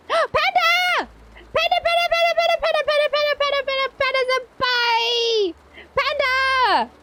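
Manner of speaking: high-pitched excited chant; excited calling. Real, not acted. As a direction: call the name over and over, thrilled. Excited greeting of arriving friend.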